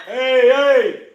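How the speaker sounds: sudden high shout of hey; yelling at dogs. Real, not acted. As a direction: cut them off with a sharp yell.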